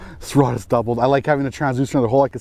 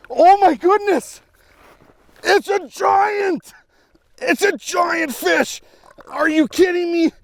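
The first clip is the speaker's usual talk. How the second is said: thrilled; 14 semitones higher than usual. Real, not acted. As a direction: shout with disbelief at the huge catch. Landing a giant fish.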